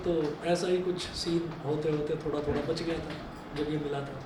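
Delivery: usual speaking voice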